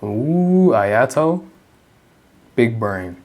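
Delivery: ordinary talk, nothing marked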